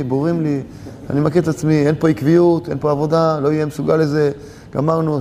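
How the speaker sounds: low, resigned monologue; acted-out despair sunk into a low monotone; defeated, giving up. Man who has failed decides to vanish into a cave, defeated.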